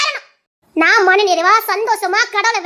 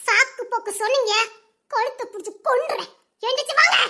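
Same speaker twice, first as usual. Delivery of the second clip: ordinary talk, nothing marked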